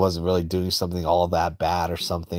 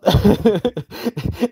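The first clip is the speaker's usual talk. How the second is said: high cackle; 12 semitones higher than usual. Real, not acted. Laughing at own joke.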